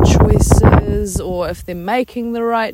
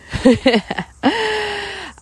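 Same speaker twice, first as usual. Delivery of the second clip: light laugh; 13 semitones higher than usual. Real, not acted. Laugh alone.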